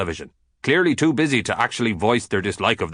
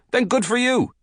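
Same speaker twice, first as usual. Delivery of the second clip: delighted; 12 semitones higher than usual. Acted. Delighted approval of listener.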